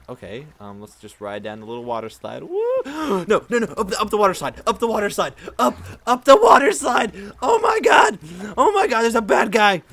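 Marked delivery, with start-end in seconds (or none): 2.8-9.8 high, loud frantic yelling; panicked screaming. Real, not acted. Panic in game danger.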